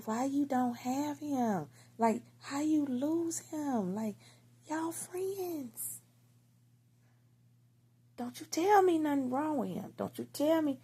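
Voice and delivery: high-pitched, drawn-out worried questioning; acting out alarmed disbelief; retold frantic demand. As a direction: demand answers from his friends, pitch way up. Acted panicked questioning of his friends.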